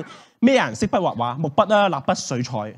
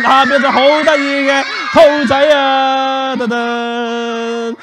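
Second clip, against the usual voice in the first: high, loud, slow showman fanfare; triumphant magic reveal; 6 semitones higher, 4 dB louder, much slower than usual. Acted. Magician reveals the cute rabbit to children, triumphant. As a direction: draw out the big ta-da.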